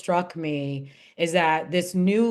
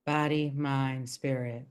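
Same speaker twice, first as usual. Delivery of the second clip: quiet echo; 7 semitones lower, 8 dB quieter than usual. Real, not acted. Listener echoes the phrase softly.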